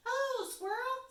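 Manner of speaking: mouse voice. Acted. Mouse greets squirrel.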